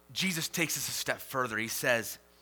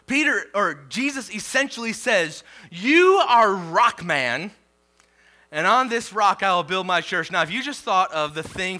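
preaching at full force; 5 semitones higher, 9 dB louder than usual. Acted. Pastor voicing Jesus naming Peter, loud preaching. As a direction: proclaim the new name grandly.

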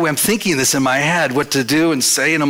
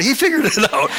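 much higher with a laugh; laughing proudly; 9 semitones higher than usual. Retold or imitated. Retelling the installer's praise, laughing and much higher. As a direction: chuckle through the punchline.

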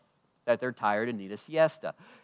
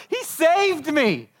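much higher, slow, gushing new convert; new believer excitement; 7 dB louder, much slower than usual. Retold or imitated. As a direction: gush like a brand-new believer.

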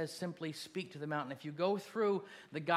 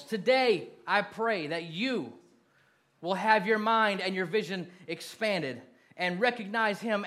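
loud, raised altar-call preaching; rally the room and lift them up; full-force preaching; 4 semitones higher, 7 dB louder than usual. Acted. Pastor calling the church to stand and declare, loud preaching.

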